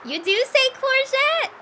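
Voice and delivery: a badly faked English accent; attempted English accent. Vlogger failing at an English accent to viewers. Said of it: "I can't do an English accent".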